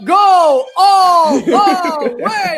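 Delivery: loud, high, stretched-out sportscaster bellow; drawn-out highlights call. Acted. Host cheers a fantasy team on, hammed-up announcer call. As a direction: stretch every word like a long touchdown call. Sportscaster catchphrase inside ad read.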